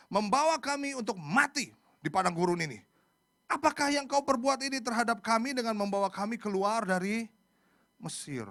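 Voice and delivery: loud, high, dramatic Bible reading; scripture reading. Acted. Preacher reads Israel's protest to Musa, loudly declaimed. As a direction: declaim the frightened people's protest.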